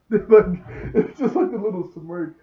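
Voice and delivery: loud, high, laughing through the words. Real, not acted. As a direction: talk while cracking up. Cracking up over the smirk.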